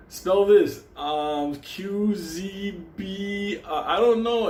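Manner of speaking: high, loud goofy contestant voice; a clueless kid stalling on stage, pitched high; mock spelling bee.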